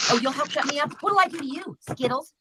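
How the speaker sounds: ordinary talk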